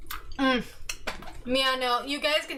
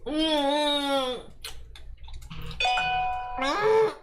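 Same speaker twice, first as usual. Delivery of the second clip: gagging; much slower than usual. Real, not acted. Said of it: "You sound like you're about to throw up".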